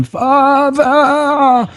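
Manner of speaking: high distant scream; scream from below. Acted. Son screaming up to his father from below. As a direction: cry out from the cellar. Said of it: "from below that scream".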